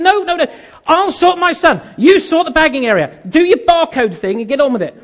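high, insistent ordering; acted exasperation. Customer orders assistant to sort bagging area, exasperated.

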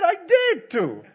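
high, loud, drawn-out comic protest; indignant retort. Retold or imitated. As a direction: insist loudly and slowly, playing the offended wife. Acting wife's retort to her husband.

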